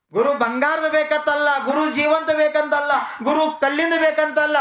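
high, ringing sermon declamation; full-force preaching. Real, not acted. Preaches on the guru.

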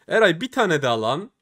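ordinary talk

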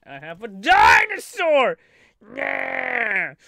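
loud excited shout. Real, not acted. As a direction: yell it out with glee. Shouting about his dinosaur.

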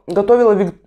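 ordinary talk, nothing marked

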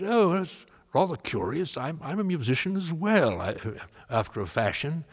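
a touch of a polite British gentleman; English gentleman voice. Retold or imitated. Elgar voiced in retold chat.

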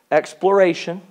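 usual speaking voice

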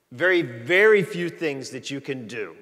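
ordinary delivery

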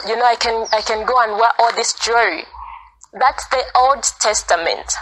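ordinary talk, nothing marked

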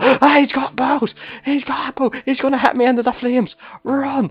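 high panicked cry about the bow; sudden alarm, voice jumps up. Real, not acted. Panics as enemy aims bow.

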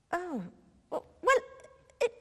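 normal talking voice

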